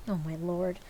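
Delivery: ordinary delivery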